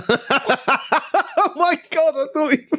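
laughing mock realisation. Imitating his flustered reply, laughing.

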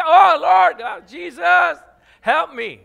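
loud, frightened yell; panicked shout. Shown as a demonstration. Preacher acts out a driver facing a crash, shouting loudly in panic. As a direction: scream for help as the car comes. Car coming at you.

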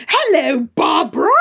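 high, loud camp English greeting. Retold or imitated. Camp English dub voice calling out to Barbara, high and loud. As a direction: camp English accent, full on. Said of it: "the voice he's gone for is like".

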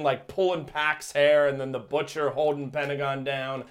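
usual speaking voice

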